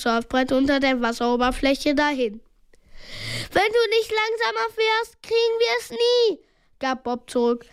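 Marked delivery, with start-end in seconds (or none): 3.5-6.4 high-pitched retort; high, insistent shout; urgent shouted reply. A narrator's reading. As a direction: call it forward during a chase. Bob shouts back during the chase.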